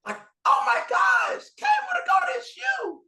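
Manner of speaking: high, loud shrieking excitement; screaming fan. Retold or imitated. Teen fan spotting him in Best Buy, shrieking. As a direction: squeal like a starstruck teen. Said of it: "and screams".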